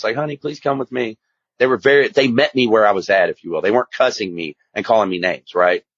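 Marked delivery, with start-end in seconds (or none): 0.2-1.2 soft, kind plea to a nephew; an aunt softly begs her nephew to come with her; gentle plea. Retold or imitated. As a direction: coax him gently, like a loving aunt. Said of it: "with a smile on her face".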